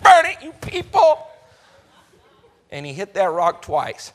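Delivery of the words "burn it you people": high, loud angry blowup; angry outburst. Acted. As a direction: explode at the crowd. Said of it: "he was angry at those people".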